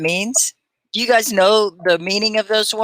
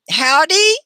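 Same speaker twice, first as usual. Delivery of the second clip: drawled howdy; 10 semitones higher, 6 dB louder than usual. Pretend. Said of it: "I'm from Texas".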